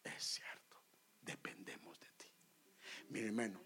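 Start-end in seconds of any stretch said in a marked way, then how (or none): 0.0-2.1 soft, whispered repentant prayer; silent prayer. Retold or imitated. Preacher repents to the Lord in his mind, whispered. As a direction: hush it like a prayer in your head. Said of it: "en mi mente yo le dije".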